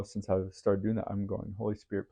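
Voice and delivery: ordinary delivery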